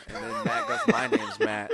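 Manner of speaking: high laughing punchline; laughing through it. Real, not acted. Comedian laughing at the two Matts, high and giggly. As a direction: crack up while saying it.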